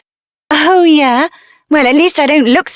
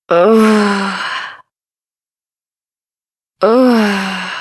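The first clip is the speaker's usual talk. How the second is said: low, dazed groan; low groan of waking from a trance; 5 semitones lower than usual. Acted. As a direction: groan coming back to yourself. Coming out of possession.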